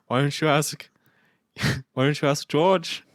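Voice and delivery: lilting mock Liverpool accent. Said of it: "I just started doing the Beatles".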